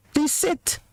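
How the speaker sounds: a too-sweet request that lacks bite; mock polite request. Shown as a demonstration. Parent asking the child too gently to sit. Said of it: "It's not serious enough".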